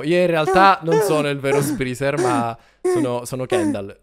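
ordinary talk

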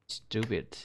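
ordinary talk

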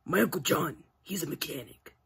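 usual speaking voice

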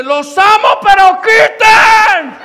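very high, drawn-out scream; desperate comic yell, loud and high; screamed warning. Retold or imitated. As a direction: scream it at full force, stretching the end. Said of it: "Yo grité".

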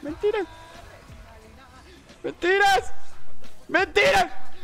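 shrill high cry; high excited yelp. Real, not acted. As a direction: squeal it high. Gaming teasing exclamation.

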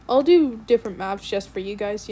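ordinary talk, nothing marked

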